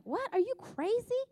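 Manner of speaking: mock alarm at the husband; retelling her shock at giving away the money; shocked disbelief. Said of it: "And I was like".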